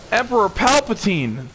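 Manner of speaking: high, slow hammed-up plea; mock pleading. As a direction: beg for your life, playfully. Mock begging a boss.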